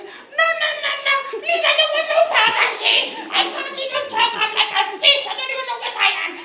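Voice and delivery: high, loud desperate pleading; frantic begging. Acted. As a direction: plead to be freed. Oba keeps begging.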